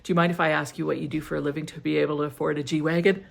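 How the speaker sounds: rattled off very fast like a street interviewer; imitating fast talker. Instagram interviewer quizzing a stranger, imitated very fast. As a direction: mimic the guy who talks so fast. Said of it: "And he talks so fast".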